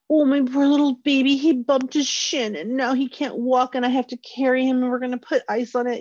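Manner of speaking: put-on high, gushing, overdramatic baby talk; babying parent. A parent babying the child over a bumped shin, high and gushing. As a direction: fuss over the child like it's a tragedy. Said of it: "you make a whole big dramatic thing".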